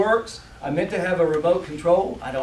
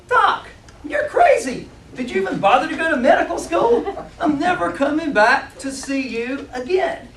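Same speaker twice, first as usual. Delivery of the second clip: much higher, laughing, incredulous mock outrage; outraged patient in denial; 11 semitones higher, 4 dB louder than usual. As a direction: squeal it like a patient refusing the diagnosis. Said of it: "And I go".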